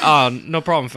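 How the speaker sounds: put-on jokey London slang accent; mock British accent. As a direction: toss it off like a London bartender. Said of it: "he's like".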